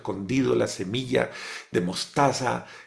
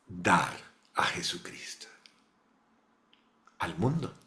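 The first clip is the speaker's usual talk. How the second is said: low, near-whispered words; hushed revelation; 6 semitones lower, 7 dB quieter than usual. Acted. The Virgin answers Leo, in a reverent hush. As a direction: breathe the words like a holy secret.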